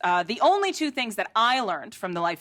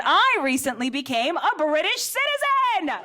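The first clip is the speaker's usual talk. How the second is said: higher, proud proclamation; excited announcement; 7 semitones higher, 3 dB louder than usual. Real, not acted. Telling the crowd her big news, excited.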